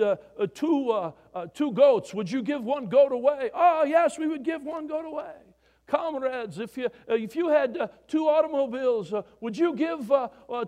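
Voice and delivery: mock Russian comrade telling a joke. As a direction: play both men in the joke, broad and loud. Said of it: "this Russian guy".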